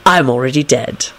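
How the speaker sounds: low, weary disdain; disgusted. A narrator's reading. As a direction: say it low with distaste. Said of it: "said in a disgusted voice".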